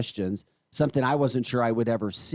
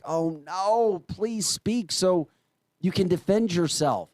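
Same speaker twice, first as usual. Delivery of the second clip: mock pleading; 5 semitones higher, slower than usual. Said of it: "I'm like".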